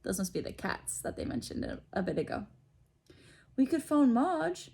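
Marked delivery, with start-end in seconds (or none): 3.6-4.7 suggesting uncle. A narrator's reading. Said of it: "Uncle Vernon suggested".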